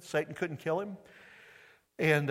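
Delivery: ordinary talk, nothing marked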